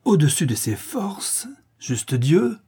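affronted, disbelieving cry; indignant exclamation. A narrator's reading. Countess appalled at her niece's reservation, indignant exclamation. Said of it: "s'écria la comtesse".

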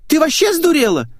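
put-on very high, loud girlish retort; squealed outrage. A narrator's reading. Girl snaps back at her friend, high squeal. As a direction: squeal it indignantly. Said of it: "взвизгнула её собеседница".

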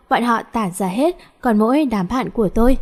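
ordinary delivery, nothing marked

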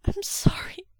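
high, thin, tearful apology; distressed, pleading voice; whispered apology. Acted. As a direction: squeak out a desperate sorry. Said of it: "Mo Xuanyu whispered".

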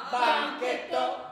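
loud, slow, teasing banchetto; mocking drawn-out banchetto. Real, not acted. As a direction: stretch banchetto out to mock it. Mocking repeat of word.

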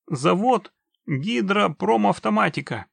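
high, loud read-out; anxious reading out. A narrator's reading. Trapped man reads factory name for witcher, anxious. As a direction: read it out urgently.

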